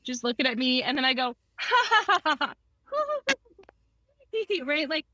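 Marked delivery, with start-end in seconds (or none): 1.6-2.5 high, staccato fake ha-ha; contrived fake laughter. Therapist acting ridiculous to get patient laughing, fake laughter. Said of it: "my contrived fake laughter".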